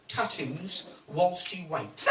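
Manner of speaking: slow, deliberate sign reading; slow punchline read. Shown as a demonstration. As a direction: read the sign slowly and deadpan. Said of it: "their slogan is".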